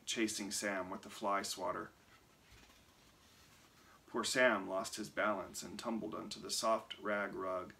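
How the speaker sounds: ordinary delivery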